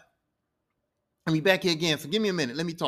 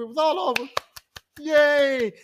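loud, higher mock hooray; celebratory cheer; 4 semitones higher, 5 dB louder than usual. Everybody is happy it is all over, celebratory cheer. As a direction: cheer it out for the whole crew. Retold celebration after success.

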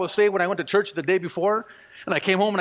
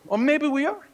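ordinary talk

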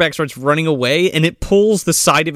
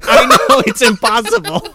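helpless laughter, higher and louder; laughing; 5 semitones higher, 5 dB louder than usual. Real, not acted. Admitting to friends the accent is impossible, laughing. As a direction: talk through a real laugh.

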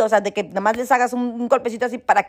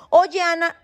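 put-on cheeky kid calling her by first name, pitched up; casual "Oye, Ana" in a higher voice; imitating a rude kid; 6 semitones higher, slower than usual. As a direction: mimic a child being overly familiar. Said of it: "¿Perdón?".

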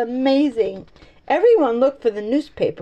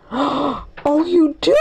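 gasp, then high rising question; astonished cry; 4 semitones higher, much slower than usual. A narrator's reading. Benny learns who Mike saw, cries out amazed. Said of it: "cried Benny".

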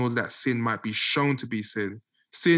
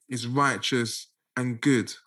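normal talking voice

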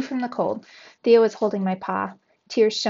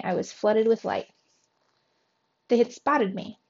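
ordinary delivery, nothing marked

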